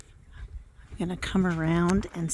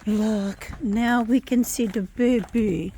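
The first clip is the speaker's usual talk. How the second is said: ordinary delivery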